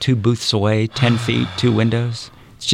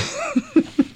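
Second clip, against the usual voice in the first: high burst of laughter. Real, not acted. Laughing at teasing.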